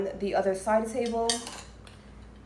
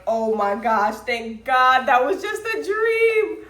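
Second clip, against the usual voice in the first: relieved; 2 semitones higher, 3 dB louder, slower than usual. Retold or imitated.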